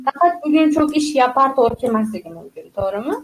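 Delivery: ordinary delivery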